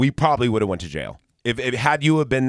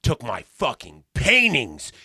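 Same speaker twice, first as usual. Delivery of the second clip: slow, punched-out accusation; 3 semitones higher, slower than usual. Retold or imitated. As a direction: lean on each word, seething and deliberate.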